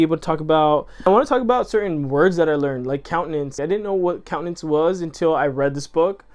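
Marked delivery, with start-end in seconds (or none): none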